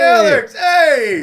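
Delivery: very loud, high cheer; excited greeting. Real, not acted. Hosts greeting Alex as they appear, high excited shout. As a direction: holler the welcome.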